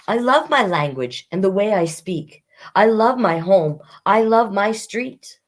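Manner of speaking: put-on bright read-aloud voice for children; reading a picture book to kids; storybook reading. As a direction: read it to the class with a lilt.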